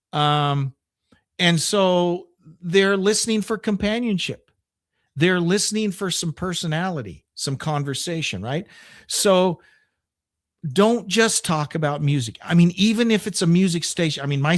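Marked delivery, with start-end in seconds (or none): none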